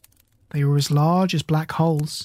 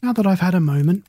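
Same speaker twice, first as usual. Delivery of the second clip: usual speaking voice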